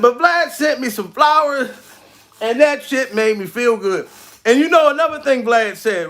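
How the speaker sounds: ordinary talk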